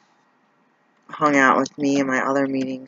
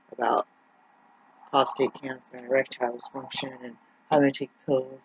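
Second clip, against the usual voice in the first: usual speaking voice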